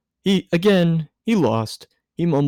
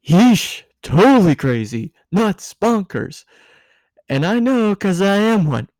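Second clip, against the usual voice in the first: high, giddy tipsy woman, voice pitched up; tipsy Misato; 9 semitones higher, 5 dB louder than usual. A narrator's reading. Tipsy Misato lecturing Shinji, high and giddy. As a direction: play the tipsy guardian, loud and high.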